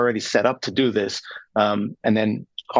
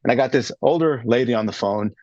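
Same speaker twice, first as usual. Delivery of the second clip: normal talking voice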